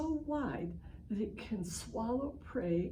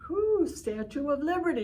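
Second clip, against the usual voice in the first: put-on higher and louder cheer for the kids; excited whoop; 8 semitones higher, 7 dB louder than usual. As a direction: whoop it with delight. Excited about jump to torch.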